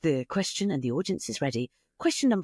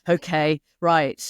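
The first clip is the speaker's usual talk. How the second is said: mock resigned shrug; 2 semitones lower, 7 dB louder, slower than usual. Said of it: "I'm like".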